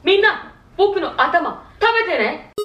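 lightly voiced cartoon hero; a hint of a gentle Anpanman. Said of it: "アンパンマンやってみ。".

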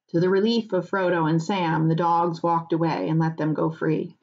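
plain reading voice

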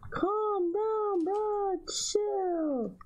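very high, thin voice telling him to chill; cartoonish high-pitched calm down; squeaky mock calm. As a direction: tease him in a tiny high voice. Mock-soothing the game companion.

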